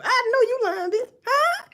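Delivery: "know" thrown up high; high disbelief. Real, not acted. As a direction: squeal it out in mock shock. Mock disbelief at someone.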